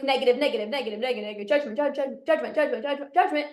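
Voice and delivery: droning, quick-running list; words tumbling out on a loop; rapid-fire repetition. Acted. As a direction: rattle the words off in a run. Piles up repeated negative thoughts.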